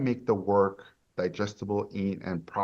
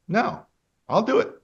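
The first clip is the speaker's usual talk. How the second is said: bright, high jump to yes; eager yes; 11 semitones higher, 5 dB louder than usual. Retold or imitated. Speaker to audience, showing his new eager yes. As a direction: catch yourself and leap to yes. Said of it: "But then I go".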